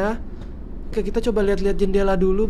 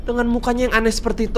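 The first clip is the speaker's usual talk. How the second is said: usual speaking voice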